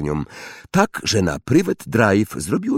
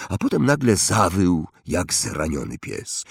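ordinary delivery, nothing marked

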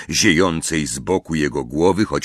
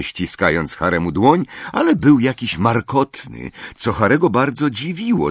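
ordinary delivery, nothing marked

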